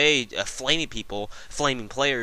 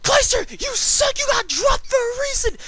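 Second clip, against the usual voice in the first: very high, slow, loud heckling scream; screaming insults; 7 dB louder than usual. Retold or imitated. Heckler screaming insults at Optic from the crowd. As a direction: bellow the insults at the rival team. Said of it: "hurling those kinds of insults".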